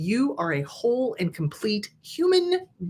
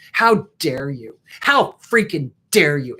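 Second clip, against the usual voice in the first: mock furious letter; 5 semitones lower, 5 dB louder than usual. Reading her pretend angry letter to the abuser, mock furious. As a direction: lower and louder, building indignation. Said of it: "you just get as angry as you need to".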